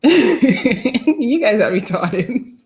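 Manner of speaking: slow, breaking up with laughter; laughing. Real, not acted. Streamer to viewers guessing the accent wrongly, laughing. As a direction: laugh all through the words.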